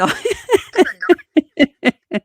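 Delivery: high, loud burst of laughter; real laughter. Real, not acted. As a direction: laugh in repeated bursts at your own mistake. Laughs at own accent mistake.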